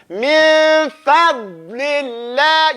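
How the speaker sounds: slow Quranic min fadlillah, min stressed; drawn-out recitation. Shown as a demonstration. Teacher modelling slow recitation for students. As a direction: recite min fadlillah slowly with the tongue pushed to the sides. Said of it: "so you say".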